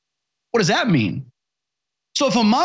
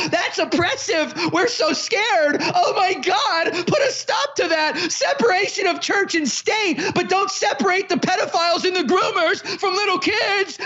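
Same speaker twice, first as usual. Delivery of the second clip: mocking falsetto rant; 7 semitones higher than usual. Shrill mocking of opponents, laughing.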